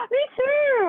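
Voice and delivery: gasping, high, loud squeal of delight; so excited. Real, not acted. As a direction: burst out high and loud, thrilled. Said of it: "Sorry, I got so excited".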